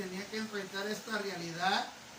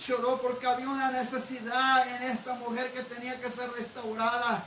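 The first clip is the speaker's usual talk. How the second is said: rising, louder preaching cadence; building sermon; 6 semitones higher, 7 dB louder than usual. Acted. Pastor preaching to the congregation about the heart, building to full force. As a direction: build the repeated lines into a loud climax.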